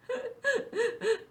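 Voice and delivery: a hammed-up high sob, well above the voice; high sobbing wail. A narrator's reading. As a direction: wail it out like a sulking fox cub. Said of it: "sobbed the fox".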